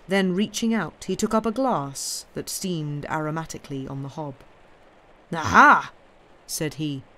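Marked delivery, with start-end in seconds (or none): 5.3-5.9 loud, sudden exclamation; gloating discovery. Acted. Intruder spotting the glass on the hob, loud exclamation. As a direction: pounce on it loudly.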